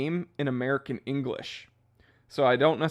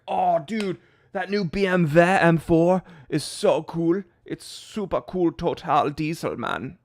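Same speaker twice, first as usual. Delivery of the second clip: exaggerated German-accented American dude, slower; mock German accent; 4 semitones higher, 5 dB louder, slower than usual. Joking about Americans saying BMW the German way. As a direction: ham up German sounds in a bro voice. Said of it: "people are going around the U S being like".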